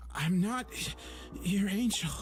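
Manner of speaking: high, loud desperate plea; beg, near tears and high; desperate pleading. Acted. Protagonist collapsing, insisting.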